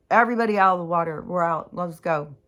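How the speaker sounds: loud, fast, rapid-fire orders; panicked parent hollering at the kids to get out; urgent shout. Pretend. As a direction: yell everyone out of the water. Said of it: "I'd be like".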